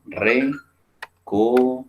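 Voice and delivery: "recoger" stretched very slowly, syllable by syllable; slow "recoger" into "re-co-ger"; very slow syllable-split "recoger"; drawn out syllables. Shown as a demonstration. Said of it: "ahí tenemos tres, tres sílabas".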